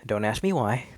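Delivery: ordinary delivery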